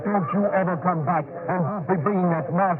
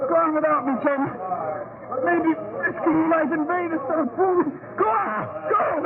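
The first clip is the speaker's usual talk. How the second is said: higher, breaking plea to go on; tearful urging, voice cracking; pleading; 7 semitones higher than usual. Acted. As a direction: beg him to leave you. Wounded man urges friend on.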